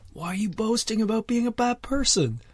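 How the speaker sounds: high-pitched incredulous protest; exasperated disbelief. Acted. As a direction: plead high-voiced for an explanation. Exasperated question to imagined boaster.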